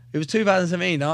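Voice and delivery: usual speaking voice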